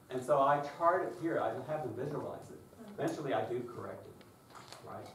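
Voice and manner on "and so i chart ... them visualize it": normal talking voice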